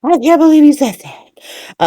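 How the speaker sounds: high, mock-shocked Tracy gasp; recapping Tracy's shocked outburst, mock alarmed; mock freaking out. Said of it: "Tracy freaking out".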